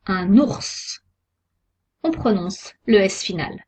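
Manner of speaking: ordinary delivery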